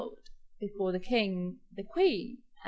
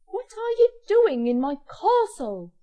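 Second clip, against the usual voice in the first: loud, high angry demand; acted ogre's outraged shout; hollering; 10 semitones higher, 10 dB louder than usual. A narrator's reading. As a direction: holler at an intruder. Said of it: "he hollored".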